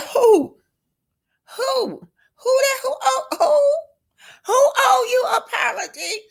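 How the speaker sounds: very high, squealed questioning; incredulous challenge. Acted. Friend challenging who owes an apology, high-pitched and incredulous. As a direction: shoot up high in mock disbelief. Destiny challenges Tisha in acted quarrel.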